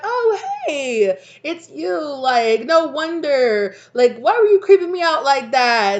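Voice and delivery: high, loud and laughing; relieved laugh of recognition, stretched out; excited recognition. Pretend. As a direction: friend unmasked, playful scolding. Recognizing the friend.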